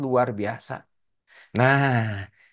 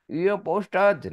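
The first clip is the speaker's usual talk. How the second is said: slow, higher Udin saying "Iya, Pak Ustadz"; drawn-out, higher sulky "Iya, Pak Ustadz"; slow, higher reply of a sulky boy to the ustadz; Udin's voice; 6 semitones higher, slower than usual. Acted. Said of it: "punten ya kalau nggak mirip ya".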